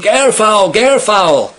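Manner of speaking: loud, high alarmed shout; scream. Acted. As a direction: scream out a warning. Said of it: "he screamed".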